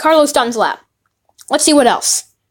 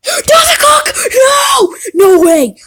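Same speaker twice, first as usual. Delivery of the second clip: excited screams; 13 semitones higher, 5 dB louder, slower than usual. Real, not acted. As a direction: gasp then very high, loud shouts. Gasps at big pull.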